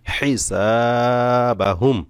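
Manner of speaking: হিসাবাহুম drawn out very slowly, ending held; slow recitation. Shown as a demonstration. Teacher recites হিসাবাহুম slowly for students. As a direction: stretch হিসাবাহুম evenly. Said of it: "সাকিন করেই ওয়াকফ করতে হবে".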